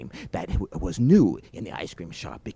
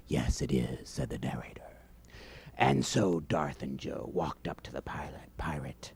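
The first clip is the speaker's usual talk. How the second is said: hushed storytelling; 4 semitones lower, 3 dB quieter than usual. A narrator's reading.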